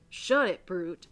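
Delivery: angry cut-off. Acted. Reverend silencing Jory, angrily and loudly. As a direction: shout the interrupter down.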